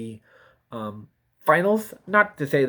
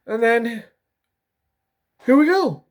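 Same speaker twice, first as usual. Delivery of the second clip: words stretched through a real yawn, pitch up and louder; 9 semitones higher, 5 dB louder than usual. Real, not acted. Mid-video vlogger yawning into the words. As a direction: let a yawn take over the words. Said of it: "Again, another yawn".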